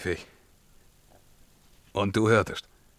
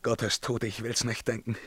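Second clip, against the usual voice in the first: ordinary talk, nothing marked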